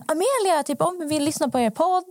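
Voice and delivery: high-pitched imitation of eager listeners; excited fan girls. Two podcast fans greeting the host in a shop, excited imitation. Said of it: "Eh, så de bara".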